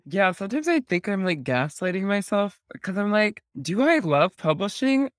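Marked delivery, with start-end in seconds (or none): 3.6-5.1 drawn-out, higher-pitched questioning of yourself; mock soul-searching, stretched out slowly; self-doubting. Host quoting doubts about loving publishing, self-doubting.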